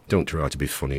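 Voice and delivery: usual speaking voice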